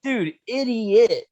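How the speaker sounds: high, slow, drawn-out scolding of a fool; exasperated. Retold or imitated. Scolding the buddy who confessed to the cop, high and drawn-out. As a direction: stretch out idiot like you can't believe it.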